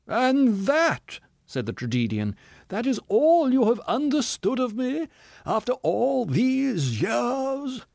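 high, slow, theatrical self-pitying voice; a wounded ham actor milking every line; tragedian. Acted.

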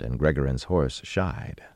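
plain reading voice

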